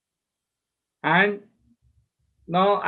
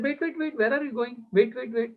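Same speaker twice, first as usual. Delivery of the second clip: acted pleading to wait; 7 semitones higher than usual. Acts out stopping his departing friend.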